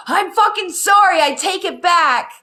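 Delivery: high, loud panicked plea that breaks into a laugh; frantic apology. Retold or imitated. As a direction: beg the universe fast, half-laughing at yourself.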